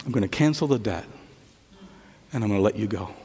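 soft, gentle forgiving king; gentle king's voice. Acted. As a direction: speak mercy softly to the kneeling man.

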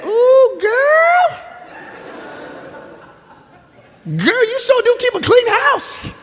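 very high, laughing, slow admiring voice; gushing auntie. Retold or imitated. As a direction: play Auntie Linda impressed by the house. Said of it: "She said".

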